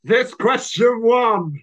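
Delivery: high, loud, slurred-out shout; drunk heckler. Retold or imitated. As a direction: drunk bellowing slowly from the back of the room. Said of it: "drunk arsehole at the back going".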